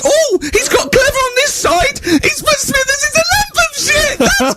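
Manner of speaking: high, slowed, wailing mock rage; teacher losing it. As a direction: wail it out like a teacher at breaking point. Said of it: "he went berserk".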